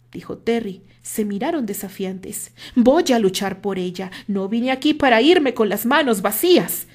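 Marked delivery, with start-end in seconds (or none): 2.8-6.9 much louder, near a yell; raised, near-shouted vow; almost shouting. A narrator's reading. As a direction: declare it defiantly. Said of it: "Casi le gritó".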